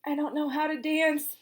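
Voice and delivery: a hint of a nervous kid; lightly voiced boy. A narrator's reading. Ron's voice in story.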